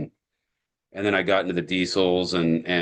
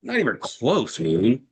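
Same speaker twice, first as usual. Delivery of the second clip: high-pitched incredulous retort; exasperated comeback, pitched high; mock disbelief; 6 semitones higher, slower than usual. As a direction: scoff at the instructions, pitch jumping up.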